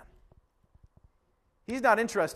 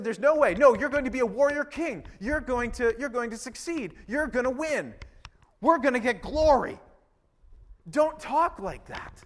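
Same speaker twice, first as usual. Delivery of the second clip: imitated insistent Peter; 5 semitones higher than usual. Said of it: "He would have said".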